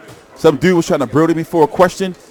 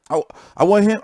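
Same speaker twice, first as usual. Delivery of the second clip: usual speaking voice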